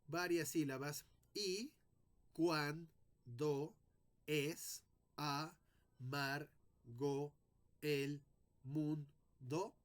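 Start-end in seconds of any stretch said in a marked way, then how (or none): 1.3-9.7 slow, each syllable set apart with pauses; counting syllables. Shown as a demonstration. Teacher counting syllables for students, slow and spaced. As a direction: split the line into separate syllables. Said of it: "tendría que contar aquí varias sílabas".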